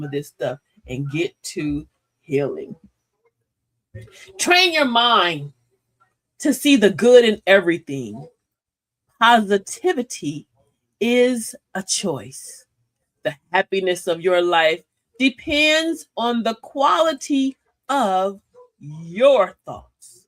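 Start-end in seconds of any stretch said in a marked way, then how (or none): 4.4-12.7 slow affirmation. Acted. Reading affirmations slowly to audience. 13.2-20.2 quote read slowly. Acted. Reads out a quote.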